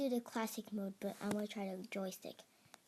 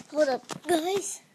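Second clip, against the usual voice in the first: sudden loud, high call; 6 semitones higher, 12 dB louder, much slower than usual. Real, not acted. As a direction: blurt it out loud. Sudden game moment.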